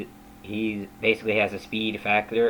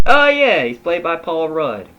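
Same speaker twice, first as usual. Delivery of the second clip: excited; 6 semitones higher, 7 dB louder than usual. Pretend. Excited fan recognizing Ant-Man.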